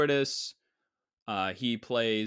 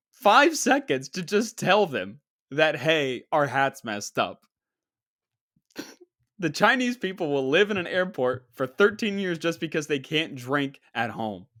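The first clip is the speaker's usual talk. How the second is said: mock rant; 4 semitones higher, 6 dB louder than usual.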